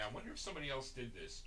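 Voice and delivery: very soft, quick aside to oneself; muttered aside. Real, not acted. Host checking song credits, hushed aside to himself. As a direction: drop right down and think aloud quickly.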